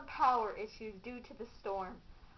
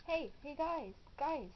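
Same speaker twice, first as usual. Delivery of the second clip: ordinary delivery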